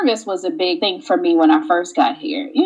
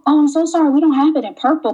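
apologetic store clerk; 7 semitones higher, faster than usual. Acted. Helpful American clerk, acted.

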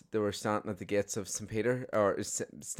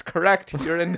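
much higher, much louder punchline; shouted; 8 semitones higher, 9 dB louder than usual. Acted. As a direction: bellow the punchline. Joke punchline, shouted verdict.

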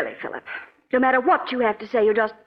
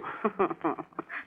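soft scornful laugh; mocking laugh; 5 semitones lower, 10 dB quieter than usual. [name] laughing off her husband's offer to forgive, soft and scornful. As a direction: laugh him off, quietly amused.

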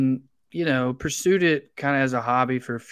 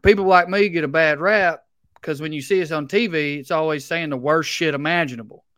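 put-on thick Southern accent; 4 semitones higher, 6 dB louder than usual. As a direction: do the friend's thick Southern accent as a light impression. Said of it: "Like, he talks like this".

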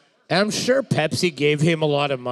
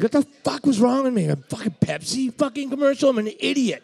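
pitched-up exasperated rant; high mock self-scolding; 8 semitones higher than usual. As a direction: whine it at yourself. Voicing Jackson regretful.

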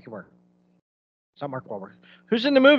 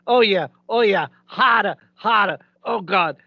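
loud mock moaning in Boston accent; hammed-up Boston moan; 4 semitones higher, 5 dB louder than usual.

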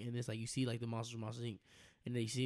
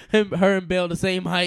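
normal talking voice

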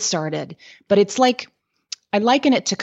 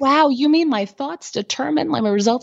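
mock amazement; 8 semitones higher than usual. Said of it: "They're like".

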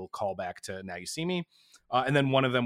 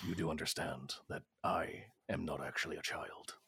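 Liam Neeson impression; 7 semitones lower, 10 dB quieter than usual. Quoting Liam Neeson Ted cameo.

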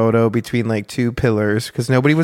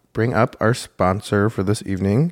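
ordinary talk, nothing marked

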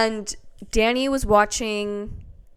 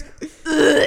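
mimicked retch with laughter around it; imitated stifled gag; 5 semitones higher than usual. Friend imitating Danny during podcast, stifled gagging. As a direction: act out a gag someone tries to suppress. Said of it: "trying to be quiet".

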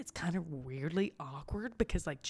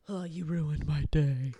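coconut hater; 5 semitones lower, 3 dB louder than usual. Retold or imitated. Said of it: "there are people who are like".

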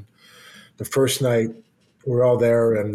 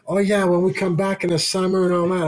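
excited wife; 6 semitones higher than usual. Retold or imitated. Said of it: "She said".

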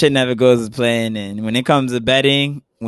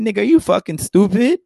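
exasperated retort; 6 semitones higher than usual. Retold or imitated. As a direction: snap it at him like he's an idiot. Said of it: "I'm like".

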